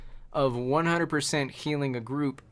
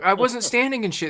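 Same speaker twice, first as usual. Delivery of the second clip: mock indignant, higher and louder; indignant denial; 9 semitones higher, 5 dB louder than usual. Imitating a dead DPS player denying the mistake, mock indignant. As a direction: protest like it's obviously not your fault.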